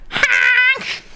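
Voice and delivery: high, slow squawking cry; goose honk voice. Pretend. Streamer imitating a goose on request, high squawky honk. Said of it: "do that honk again".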